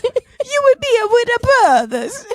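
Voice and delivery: high mock Italian accent through laughter; laughing, hammed-up cartoon Italian; Jared Leto. Host imitates the Gucci movie actor, laughing Italian. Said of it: "He's like".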